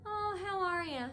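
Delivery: mimicking the lady. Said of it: "she's like this".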